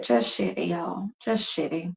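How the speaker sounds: defeated. Real, not acted. Grieving dog's death.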